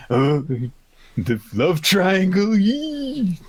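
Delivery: rising squeal ending in yee; giddy shipper squeal. Retold or imitated. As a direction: swoon over the love triangle. Voicing shipper character.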